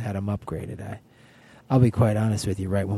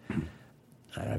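mock whisper; 7 semitones lower, 12 dB quieter, much slower than usual. Seducing her in the hotel lounge.

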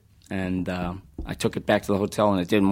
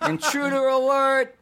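high buzzing larynx-box alarm; alarm shout; 14 semitones higher than usual. Retold or imitated. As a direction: blare it like an electrolarynx alarm. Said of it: "Reference to a classic video game".

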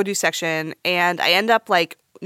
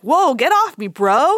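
imagined man protesting; 6 semitones higher, 4 dB louder, slower than usual. Pretend. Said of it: "if I was a man".

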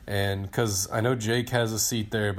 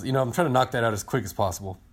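usual speaking voice

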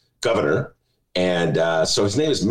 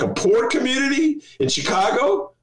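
normal talking voice

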